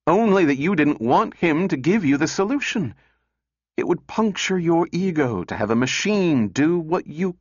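heated accusation. A narrator's reading. Calvin accuses Lanning in quarrel.

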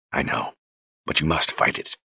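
soft, near-whispered comfort; hushed soothing. A narrator's reading. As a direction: murmur it gently to her. Richard soothing quietly.

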